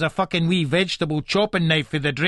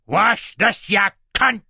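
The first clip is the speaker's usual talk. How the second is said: raised, furious command; angry order; 5 semitones higher, 4 dB louder, slower than usual. Acted. Husband holding wife's head in sink, furious.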